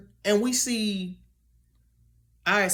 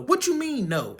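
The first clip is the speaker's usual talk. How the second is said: mock stern parent, pitched higher; a hint of a scolding mom; 7 semitones higher than usual. A parent challenges a child's no, in a mock stern voice. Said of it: "you get hit with a".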